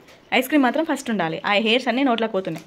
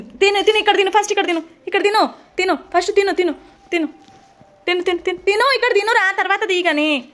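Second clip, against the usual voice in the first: urgent coaxing; 6 semitones higher than usual. Real, not acted. Urging child to eat melting ice cream.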